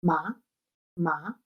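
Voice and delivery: short rising MA, like a quick question; second tone. Shown as a demonstration. Teacher demonstrating the second tone, short questioning "ma". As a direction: ask "ma?" briefly, pitch going up. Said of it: "suena como una pregunta corta".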